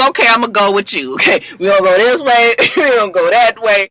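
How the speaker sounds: lightly voiced giggly drunk, a bit fast; a hint of a fake drunk, giggling; mock drunk acting. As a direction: play a tipsy girl laughing as she wanders. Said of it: "that's a little bit better on the drunk acting".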